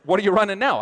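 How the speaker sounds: high, loud demanding question; imitated woman challenging.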